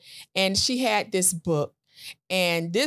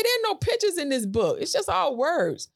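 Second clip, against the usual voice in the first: incredulous; 8 semitones higher than usual. Retold or imitated. Retold own disbelief at book.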